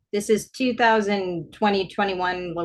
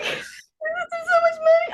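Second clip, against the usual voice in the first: very high, drawn-out mock wail; imitated hysterics; 3 dB louder, slower than usual. Imitating mom fretting about money, hysterical. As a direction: stretch it into a fake sob. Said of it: "really hysterical on the phone".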